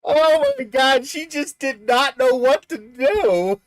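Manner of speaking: high, loud, drawn-out words through laughter; helpless laughing. Real, not acted. Storyteller recalls the look on her face, helplessly laughing. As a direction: squeal it out slowly while cracking up. Cracking up at his own story.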